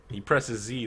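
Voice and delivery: ordinary delivery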